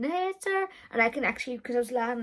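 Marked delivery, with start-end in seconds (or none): 0.0-0.7 excited announcing. Retold or imitated. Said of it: "everyone was saying".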